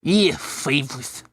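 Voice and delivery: put-on slow lisping kid voice; drawn-out "favorite" through a lisp; slight lisp. A narrator's reading. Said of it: "talks with a bit of a lisp".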